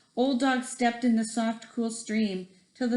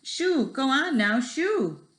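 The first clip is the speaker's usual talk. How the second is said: higher, lightly voiced shooing; 5 semitones higher, slower than usual. A narrator's reading. The musicians shooing the wet dog, mildly higher. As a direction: shoo the dog along.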